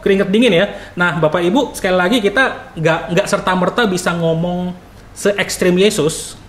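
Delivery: usual speaking voice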